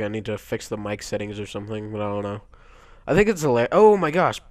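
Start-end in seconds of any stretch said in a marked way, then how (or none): 3.1-4.4 loud, high, interrupted outburst; alarmed reaction, pitched up; startled exclamation. Real, not acted. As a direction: break off and cry out at the game. Startled mid-sentence by game.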